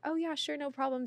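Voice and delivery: high, light, casual reply; retold breezy agreement. Wife lets the star sit closer, high and breezy. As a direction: act casual, pitched well up.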